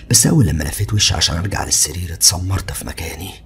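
ordinary delivery, nothing marked

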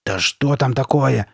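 plain reading voice

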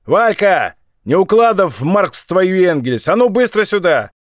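high, loud, furious summons; enraged shout. A narrator's reading. Furious young thug calls his mate through the door, shouting. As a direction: bellow through a door for someone to come now. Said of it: "Взбешённый юнец крикнул в приоткрытую дверь".

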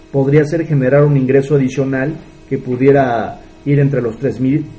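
ordinary talk

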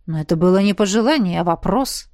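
ordinary delivery

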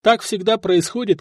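ordinary delivery, nothing marked

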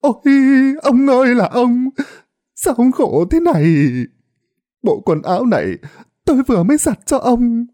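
high, keening wail for the dead husband; wailing lament. Acted. Wife wailing over her drowned husband's corpse, high keening lament. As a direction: cry out over his body, grief breaking loose. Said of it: "miệng không ngừng kêu gào".

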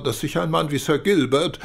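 usual speaking voice

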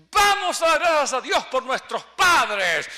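slow, very loud call to the crowd; rally everyone to thank God; loud announcement. Retold or imitated. Son calling the whole family to give thanks, loud and slow.

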